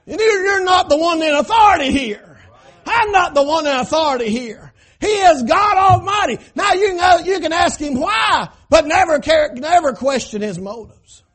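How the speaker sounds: high, shouted pulpit rebuke; forceful preaching. Real, not acted. Preacher telling the congregation who has authority, forceful preaching. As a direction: drive it home at full preaching pitch.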